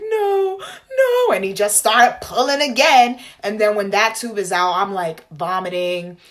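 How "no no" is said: high, loud, half-laughing, half-crying no; panicked pleading no. Retold or imitated. To the surgeon pulling the tube, a high laughing-crying no. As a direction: beg him to stop, laughing through tears. Begging as second tube pulled.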